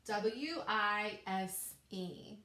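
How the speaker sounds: W I S E spelled letter by letter, slow; teacher spelling W I S E aloud; spelling slowly. Shown as a demonstration. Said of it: "So how do we spell wise?".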